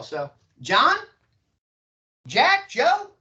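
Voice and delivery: names called out high and loud, rising; bright rising call of a buddy's name; familiar upward greeting. Shown as a demonstration. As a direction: greet them like an old friend, pitch climbing. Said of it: "it implies familiarity as well".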